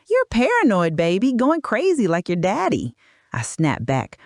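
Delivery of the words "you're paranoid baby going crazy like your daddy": high-pitched mocking jeer; laughing mockery. A narrator's reading. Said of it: "he laughed".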